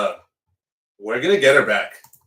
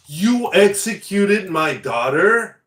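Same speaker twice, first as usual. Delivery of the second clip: mock outrage; 6 semitones higher, 7 dB louder, slower than usual. Mocking a grieving parent's outrage.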